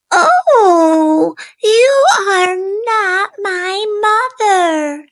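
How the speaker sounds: high, crying little bird; a chick near tears at the mistake; tearful baby bird; upset baby bird. Acted.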